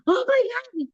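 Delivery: a high, exclaimed Oh my God; exclamation. Shown as a demonstration. Preacher illustrating the exclamation mark, high surprised exclamation. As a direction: show what an exclamation mark sounds like. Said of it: "hay signo de admiración".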